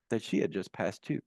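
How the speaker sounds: ordinary delivery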